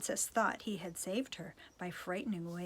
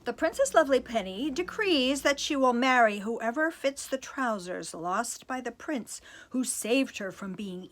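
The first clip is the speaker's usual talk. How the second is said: loud, grand herald reading; royal proclamation; 3 semitones higher, 8 dB louder than usual. Acted. Herald reads the princess's decree, loud and grand. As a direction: announce it like a town crier.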